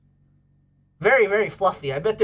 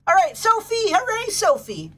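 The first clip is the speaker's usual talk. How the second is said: much higher, bright cheer for the dog; excited dog greeting; 10 semitones higher than usual. Real, not acted. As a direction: cheer the dog's name like a happy owner. Greeting a viewer's dog on stream.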